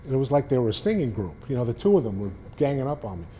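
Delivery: ordinary talk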